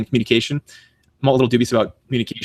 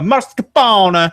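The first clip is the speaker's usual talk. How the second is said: mock Italian, drawn out, high and loud; overdone Italian accent; 8 semitones higher, 6 dB louder, much slower than usual. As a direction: roll it out in exaggerated Italian. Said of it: "was Italian".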